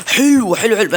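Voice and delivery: high, loud happy yelp; delighted. Real, not acted. Gamer finds great loot, high happy cheer.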